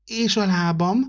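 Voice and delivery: a small tree worried it can't walk; little fir tree's voice. Acted. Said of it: "toporgott a kisfenyő".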